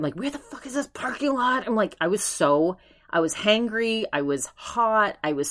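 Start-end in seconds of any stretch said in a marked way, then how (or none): 0.1-1.7 swearing. Retold or imitated. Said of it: "I was, like, swearing at him".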